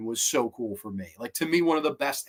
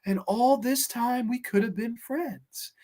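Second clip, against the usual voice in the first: a higher, slow, lightly voiced broken Baby Jane; drawn-out and slow, a faint sigh of lost years; imitating Baby Jane's line; 9 semitones higher, slower than usual. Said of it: "she just shakes her head like".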